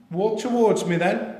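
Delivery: soft, coaxing calm; talking to a ghost. Real, not acted. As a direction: gently reassure an unseen presence. Coaxing a spirit to the door.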